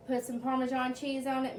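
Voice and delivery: ordinary talk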